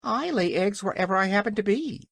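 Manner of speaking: high-pitched hen voice; defiant hen. A narrator's reading. Hen answers the furious King, defiant. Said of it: "said the Hen".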